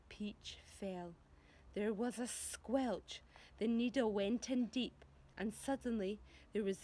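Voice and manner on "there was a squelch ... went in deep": usual speaking voice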